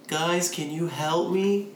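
lightly mock the trickster's sweet voice; hammed-up pitiful voice from behind the door; fake innocent plea. Stranger faking innocence again.

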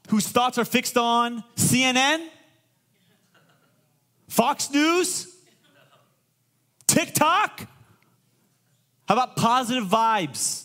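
high, loud preaching. Real, not acted. Preaching at full force.

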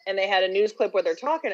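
usual speaking voice

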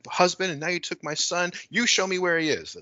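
acted panic. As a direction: plead and accuse in mock panic. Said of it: "she's kinda panicking".

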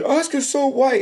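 high, hammed-up slogan; mock it like a self-important activist; mocking buffoon voice. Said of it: "that buffoon that just wants to go up and say".

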